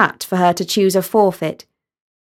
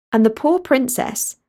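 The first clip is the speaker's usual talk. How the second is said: ordinary delivery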